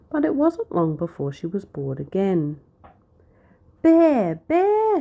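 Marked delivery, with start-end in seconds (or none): 3.8-5.0 very high, loud alarm call; loud cry. A narrator's reading. Shepherd girl fake-alarming the town, yelling. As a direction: yell it as loudly as she can. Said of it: "she cried as loudly as she could".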